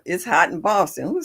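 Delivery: ordinary talk, nothing marked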